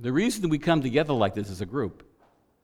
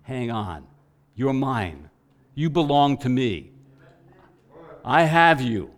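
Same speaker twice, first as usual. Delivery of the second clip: voice of the Spirit; 2 semitones higher, 7 dB louder, slower than usual. Acted. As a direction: speak as the Spirit, rising into a bold promise. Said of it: "the voice of the Spirit that says".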